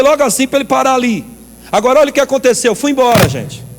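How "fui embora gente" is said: ordinary talk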